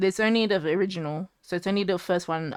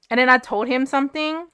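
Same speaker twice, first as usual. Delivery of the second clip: ordinary talk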